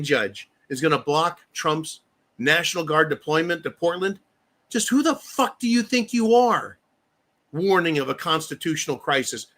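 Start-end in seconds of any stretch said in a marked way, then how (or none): 4.7-6.8 indignant challenge. Acted. Host confronting the Oregon judge with high, indignant outrage. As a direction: demand it like you're squaring up to the judge.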